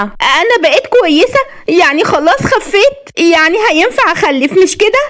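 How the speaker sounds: very high, excited questioning; overjoyed. A narrator's reading. Wife learns she is cured, overjoyed. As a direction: cry it out high with joyful disbelief. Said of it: "وهي بتصرخ وبتقول بفرحة".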